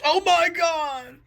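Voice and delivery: high, slow, hammed-up wail; mock anguished shout. God wounded by an arrow, hammed-up shout. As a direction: cry out long and high in mock agony. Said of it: "the God shouts".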